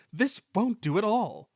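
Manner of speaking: high, loud haughty complaint; indignant grumble; grumbling. A narrator's reading. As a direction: complain like a snooty engine. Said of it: "grumbled Sir Handel".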